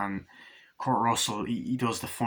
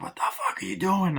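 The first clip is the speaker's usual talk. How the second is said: high raised accusing yell; a furious crewman; mock angry yell; 9 semitones higher than usual. Other crewman yells at the attacker, high angry voice.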